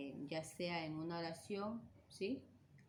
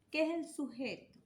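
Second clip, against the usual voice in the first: emphatic question; 8 semitones higher, 6 dB louder than usual. Real, not acted. Teacher asks class loudly.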